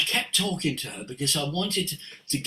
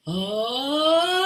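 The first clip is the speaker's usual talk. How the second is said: long, high "ah" opening up; silky "ah"; 11 semitones higher than usual. Retold or imitated. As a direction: imitate her creamy, sustained "ah". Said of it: "there was this silky coffee".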